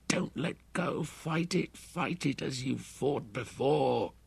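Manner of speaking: high, thin inner voice, urgent but soft; tiny inner voice. A narrator's reading. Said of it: "a tiny voice screamed in his roaring head".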